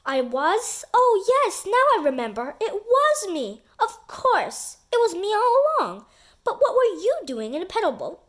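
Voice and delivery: put-on high, loud excited kid voice; a boy suddenly remembering, thrilled; a kid gets carried away with his own story; excited Terry.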